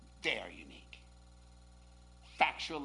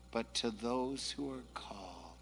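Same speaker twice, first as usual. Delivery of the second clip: soft call-and-response; 10 semitones lower, 9 dB quieter than usual. Shown as a demonstration. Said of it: "Could we say that phrase together?".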